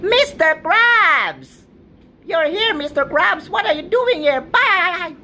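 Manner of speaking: very high, squeaky cartoon voice; high-pitched cartoon kid voice; SpongeBob-style squeak. High cartoon voice greeting boss.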